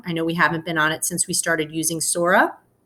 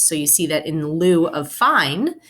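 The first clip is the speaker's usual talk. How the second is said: normal talking voice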